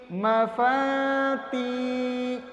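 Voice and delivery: short ما, then long-held فا and تی; drawn-out syllables. Shown as a demonstration. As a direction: stretch فا and تی slowly and evenly. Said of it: "پورا پہلے کھینچو آرام سے". (ما، فا، تی کو کھینچ کر)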